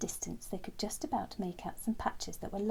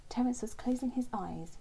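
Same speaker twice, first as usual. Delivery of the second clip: ordinary delivery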